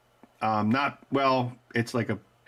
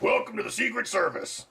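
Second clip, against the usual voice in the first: a light put-on hero voice, higher; mock movie hero; 8 semitones higher than usual. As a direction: toss off the hero's line in a movie-star voice. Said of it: "and he's like".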